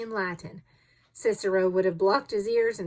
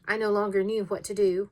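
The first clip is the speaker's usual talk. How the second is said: ordinary delivery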